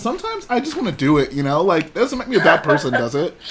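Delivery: high squeaky creepy-cheerful voice; Jedi Bob impression. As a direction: squeak it out like a sweetly unhinged guy. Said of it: "That's my Jedi Bob impression".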